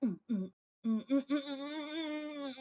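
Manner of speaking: insistent "mm" grunts climbing in pitch; aggressive head-nod humming. Retold or imitated. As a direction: hum hard to a beat, rising and stretching out. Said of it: "it's not even a subtle".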